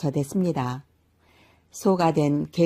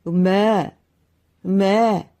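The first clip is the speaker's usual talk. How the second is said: loud mooing; the cow-man mooing as the old man talks; 3 semitones higher, 5 dB louder, slower than usual. A narrator's reading. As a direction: moo out loud. Cow moos again.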